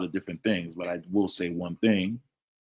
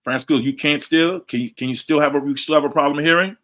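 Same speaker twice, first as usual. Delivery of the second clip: louder, higher, checking the connection; calling to check mic; 6 semitones higher, 6 dB louder than usual. Real, not acted. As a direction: call out to see who can hear you. Checking if listeners hear him.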